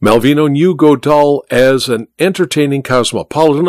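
plain reading voice

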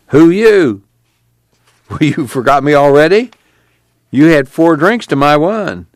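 put-on high, loud drunken old man, teasing; slurring and laughing at Tom like a drunk uncle; drunk Uncle John.

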